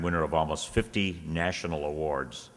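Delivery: ordinary delivery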